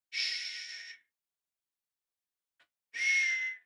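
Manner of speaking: soft, drawn-out "shh"; posed hushing, all breath; secretive shushing. Pretend. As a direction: hush someone as if sharing a secret. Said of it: "Shushing secret finger to lips".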